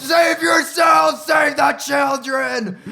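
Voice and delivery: hammed-up heroic dying scream; crazy neighbor's melodramatic cry. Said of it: "He's like".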